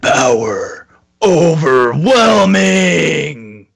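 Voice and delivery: very slow, booming otherworldly shout; Archon impression. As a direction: stretch each word out like a giant energy being. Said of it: "do your best Archon impersonation".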